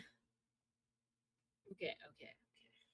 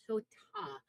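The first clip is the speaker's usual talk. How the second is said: SOTA with a drawn-out final A; small-town Midwest accent, long open vowel; regional Midwest accent; 4 semitones lower than usual. Shown as a demonstration. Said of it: "sota in my accent".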